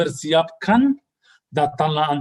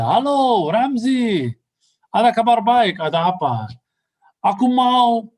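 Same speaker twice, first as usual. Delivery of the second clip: excited phone greeting; 7 semitones higher, slower than usual. Retold or imitated. Retold phone call, excited man.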